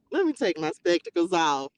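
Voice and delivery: ordinary talk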